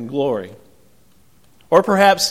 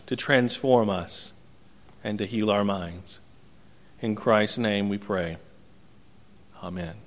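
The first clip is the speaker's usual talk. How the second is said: soft, hushed prayer voice; 5 semitones lower, 5 dB quieter than usual. Acted. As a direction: pray it quietly and reverently, words trailing down. Closing prayer before sermon.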